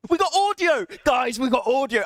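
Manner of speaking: high, delighted cry of relief; overjoyed shout. Real, not acted. Streamer telling chat the audio finally works, thrilled.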